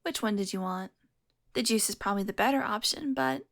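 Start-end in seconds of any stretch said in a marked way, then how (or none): none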